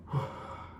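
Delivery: long, heavy exhale; heavy sigh. Real, not acted. Father recalling the call about his daughter, heavy sigh. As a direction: let out a real, weighty sigh.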